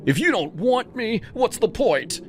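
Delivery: desperate wail; despairing threat. Acted. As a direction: threaten in anguish. Jake threatens from the top.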